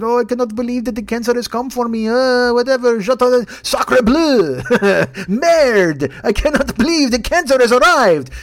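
hammy fake French, high and loud; overdone French whine; mock French accent. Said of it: "he's gonna be like".

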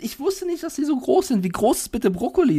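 amazed at how big a broccoli really is; disbelief. Real, not acted. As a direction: still can't believe how big it is.

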